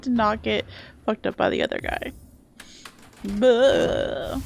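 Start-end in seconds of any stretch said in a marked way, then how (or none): 3.2-4.4 quick dumb bro grunt; frat bro. Retold or imitated. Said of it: "frat guy voice line".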